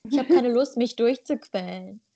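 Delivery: usual speaking voice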